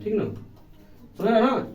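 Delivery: ordinary delivery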